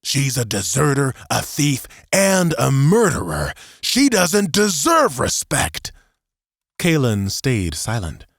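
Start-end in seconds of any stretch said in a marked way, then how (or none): 0.0-5.9 rising angry outburst, louder at end. A narrator's reading. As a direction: build to an angry shout.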